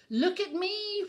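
high, loud cry of joy; excited cry. A narrator's reading. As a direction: call out proudly from the leap. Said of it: "Whale cried as he leapt".

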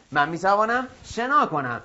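ordinary talk

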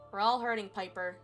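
plain reading voice

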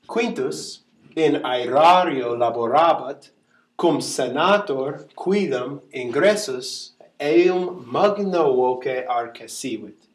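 slow, measured Latin with every accent audible. Shown as a demonstration. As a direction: read slowly so each vowel and stress is heard. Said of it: "a little slower".

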